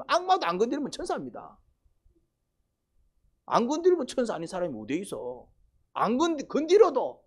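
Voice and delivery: high, loud fiery sermon climax; preaching at full force. Acted. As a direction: build the sermon to a shouting peak. Preaching climax, rising intensity.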